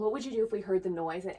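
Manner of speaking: ordinary talk, nothing marked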